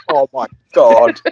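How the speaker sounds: ordinary talk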